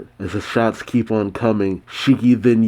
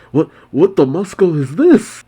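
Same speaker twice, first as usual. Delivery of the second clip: higher-pitched panicked questioning; 7 semitones higher, 4 dB louder than usual. A narrator's reading. Moscow as gas fills the room, panicked. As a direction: stammer it out in alarm. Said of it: "panics, saying".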